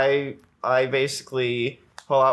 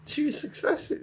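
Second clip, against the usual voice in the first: whispered; 6 dB quieter than usual. Real, not acted. Repeated muttered answer.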